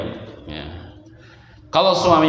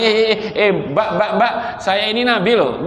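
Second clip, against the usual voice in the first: high, insistent calling for attention; mock protest; 8 semitones higher than usual. Preacher imagining the Prophet correcting the woman, mock protest. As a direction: act out an indignant correction, half in jest.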